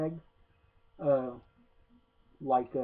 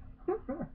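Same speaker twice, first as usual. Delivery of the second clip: short high-pitched laugh, slightly softer; real amused laugh at the movie; high giggle; 13 semitones higher, 7 dB quieter than usual. Real, not acted. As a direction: burst into a brief high giggle. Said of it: "I'm just laughing like".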